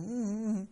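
closed-mouth mm mm mm pitched high, brushing him off; refusing hum. Retold or imitated. Boy hushing his buddy during prayer, closed-mouth hum. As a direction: hum no without opening the lips. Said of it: "So I said".

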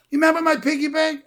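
put-on high-pitched childlike excitement; eager little boy. Boy reminding his sister of his savings, excited. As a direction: pipe up as the proud kid.

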